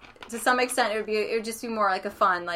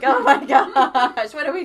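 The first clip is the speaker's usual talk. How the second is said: mock panic; 5 semitones higher, 10 dB louder than usual. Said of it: "We're like".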